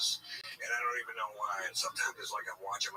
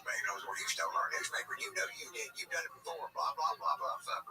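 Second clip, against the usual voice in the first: mocking the neighbor; 7 semitones higher than usual. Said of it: "or whatever she said".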